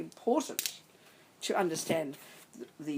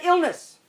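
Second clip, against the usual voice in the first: illness punched higher and louder; stress on illness; 12 semitones higher, 13 dB louder than usual. Shown as a demonstration. Teacher driving home the word illness, punched. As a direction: hit the word illness hard. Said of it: "We say the word like".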